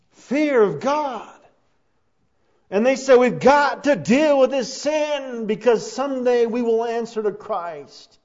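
loud, high, drawn-out key phrases; full-force preaching. Real, not acted. Preacher warning the congregation about fear of God, loud and impassioned. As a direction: thunder each phrase slowly at the congregation.

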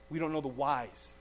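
normal talking voice

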